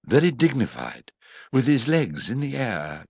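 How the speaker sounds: ordinary delivery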